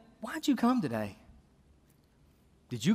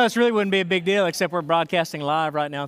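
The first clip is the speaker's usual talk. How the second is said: ordinary talk, nothing marked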